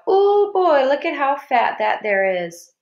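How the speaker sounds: lightly voiced boy hollering at a squirrel. A narrator's reading. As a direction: call out excited across the playground. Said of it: "hollered out".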